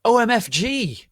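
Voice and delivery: high, loud shriek; shocked yell. Acted. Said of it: "I yielded as I jumped up".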